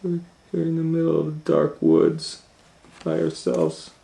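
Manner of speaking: put-on high, loud frightened girl voice; scared little girl. A narrator's reading. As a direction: pitch way up, worried. Gretel afraid in dark woods.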